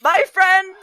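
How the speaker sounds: high, loud, slow outcry; distraught shout. Acted. Baku crying out over the lost friend, distraught shout. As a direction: shout it out in grief, drawing it out. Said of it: "And Baku is like".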